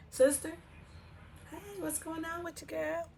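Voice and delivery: high, lightly acted phone greeting; sister on the phone. Woman greets her sister on a pretend call, high phone greeting. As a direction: pick up with a high, drawn-in hello to family.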